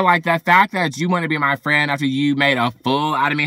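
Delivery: usual speaking voice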